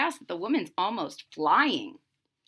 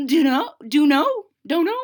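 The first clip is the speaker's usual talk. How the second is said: growling ghoul; 4 semitones higher than usual. Acted. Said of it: "cried, growled the ghoul".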